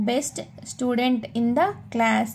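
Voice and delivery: very slow, word by word; slow model reading. Shown as a demonstration. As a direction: read it out slowly for learners. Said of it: "teacher models example".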